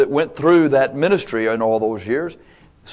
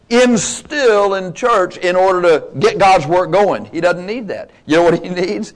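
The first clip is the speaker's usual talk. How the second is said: preaching fervor; 5 semitones higher than usual. Acted. Preacher at full force.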